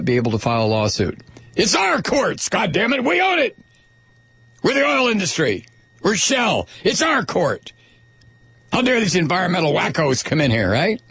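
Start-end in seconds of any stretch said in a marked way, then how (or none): 1.5-7.7 high, hammed-up indignant rant; outraged oil executive. Voicing Shell as outraged owner. 8.7-11.0 indignant oil executive. Retold or imitated. Shell voice continues, mocking.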